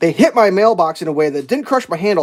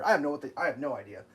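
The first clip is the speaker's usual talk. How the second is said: normal talking voice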